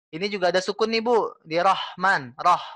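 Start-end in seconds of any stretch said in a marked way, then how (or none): none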